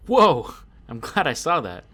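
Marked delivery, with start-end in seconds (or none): 0.1-0.5 surprised. Real, not acted. Spots the tripwire just in time.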